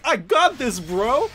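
loud, high overconfident yell; cocky bro shout. Retold or imitated. Streamer imitating his cocky companion, loud and high. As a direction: shout it like the doomed teammate. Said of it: "my dude just like".

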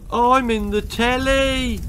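put-on boy trapped in the TV crying out; cried out. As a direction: call out desperately. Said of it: "cried Herbert".

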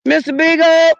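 high, loud call. Real, not acted. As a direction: shout the dog's name. Calling for lost dog.

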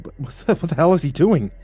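startled, tripping over the words; sputtering. A narrator's reading. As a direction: sputter and restart the question. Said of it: "I sputtered".

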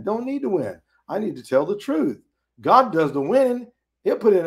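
normal talking voice

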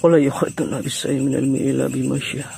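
normal talking voice

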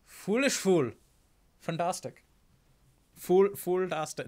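lightly voiced haughty prosecutor; a hint of a scornful prosecutor; Franziska von Karma. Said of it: "your Franziska von Karma impression".

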